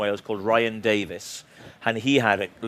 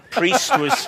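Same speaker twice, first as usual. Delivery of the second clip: laughing; 12 semitones higher, 3 dB louder than usual. Real, not acted. Laughing through a risque joke.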